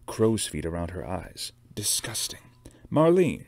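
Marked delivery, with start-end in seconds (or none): 2.9-3.4 high, loud, sharp summons; sharp call. A narrator's reading. Boss summoning her makeup artist, sharply. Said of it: "she said sharply".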